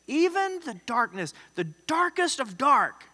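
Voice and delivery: high, slowed punch on DARKEST and DARK; emphatic amazement. Shown as a demonstration. Preacher marvelling at the psalmist's statement, high and emphatic. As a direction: exclaim it, stretching DARKEST of DARK.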